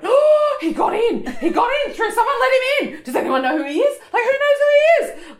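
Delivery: high, loud and laughing, she gets swept up; half-laughing excited call about the man who got in. Retold or imitated. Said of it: "And I went".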